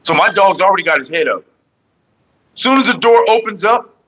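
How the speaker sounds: ordinary talk, nothing marked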